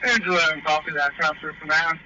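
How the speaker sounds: loud, high shout through a mask; yelling over the fire into the radio; firefighter shouting through mask. Real, not acted.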